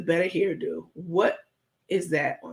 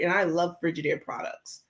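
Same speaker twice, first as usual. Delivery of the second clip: ordinary delivery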